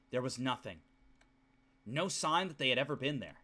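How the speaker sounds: low, soft narration read from the novel; story narration. Acted. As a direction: read the bleak scene quietly and low.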